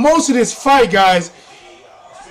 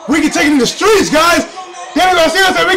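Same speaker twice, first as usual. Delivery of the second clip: excited shout; 6 semitones higher than usual. Real, not acted. Telling viewers about the ringside argument, shouting. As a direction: shout it over the crowd noise.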